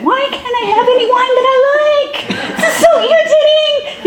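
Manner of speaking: put-on high, whiny childish tantrum voice with a laugh; squeaky mock tantrum, laughing through it; inner hissy fit. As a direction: play your inner brat throwing a fit. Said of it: "this inward hissy fit".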